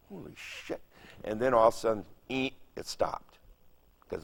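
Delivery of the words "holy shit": breathless whispered holy shit; whispered awe. Retold or imitated. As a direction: hush it out in disbelief. Awed inner thought, unvoiced.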